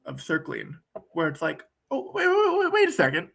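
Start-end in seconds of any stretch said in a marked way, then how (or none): 1.9-3.3 high, playful wait wait; warrior interruption. Retold or imitated. Circler stopping a partner mid-sentence, high surprised wait. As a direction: halt them with a high, bright interjection. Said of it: "warrior is this aspect of circling".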